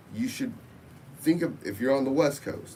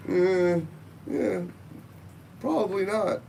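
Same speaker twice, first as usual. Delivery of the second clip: high, doubtful "eh, yeah, probably not"; skeptical; 9 semitones higher than usual. Retold or imitated. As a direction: brush it off with high-pitched doubt. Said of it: "and I'm like".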